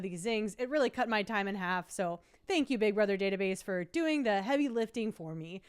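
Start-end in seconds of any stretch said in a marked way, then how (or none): none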